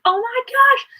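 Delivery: high, loud gasp of delight; excited squeal. Retold or imitated. Retelling seeing a live version, thrilled squeal. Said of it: "and I was like".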